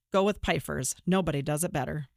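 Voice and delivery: ordinary delivery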